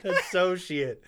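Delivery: laughing through the words. Real, not acted. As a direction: say it while cracking up. Laughing at the mistake.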